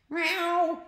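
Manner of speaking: high, loud cat meow; piercing mock cat cry; attacking cat yowl. Narrator voices attacking cats.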